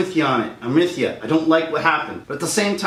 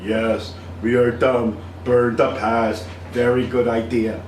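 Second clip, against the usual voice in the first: lower, slowed mock-dumb voice; lightly voiced dumb fan, lower and slow; dumb fan parody; 5 semitones lower, slower than usual. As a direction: play the brainwashed fan agreeing stupidly.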